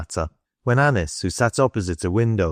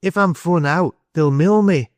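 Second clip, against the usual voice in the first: put-on high, louder dialect boy's voice; despairing boy; 9 semitones higher, 4 dB louder than usual. A narrator's reading. Boy tells adults he will be beaten, despairing. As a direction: blurt it out in a raised pitch.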